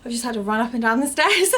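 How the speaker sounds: panting a little; out of breath. Real, not acted. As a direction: still winded from the stairs. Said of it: "sorry, I am so out of breath".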